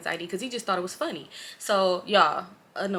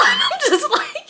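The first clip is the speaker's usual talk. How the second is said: high slow laugh through the words; 6 dB louder, much slower than usual. Real, not acted. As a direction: laugh it out, squeaky.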